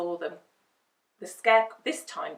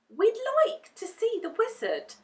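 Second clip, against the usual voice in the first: put-on young girl voice; 5 dB louder than usual. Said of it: "Dorothy told the soldier".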